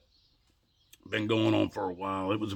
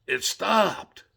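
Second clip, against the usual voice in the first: high-pitched, insistent retold exclamation; exasperated high cry of news; emphatic announcement; 11 semitones higher, 4 dB louder than usual. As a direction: pitch it way up to make them listen. Retold announcement to silenced room.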